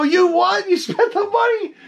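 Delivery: comic high squeak of disbelief; retold incredulous laugh. Collector reacting to Frank spending his payment, laughing disbelief.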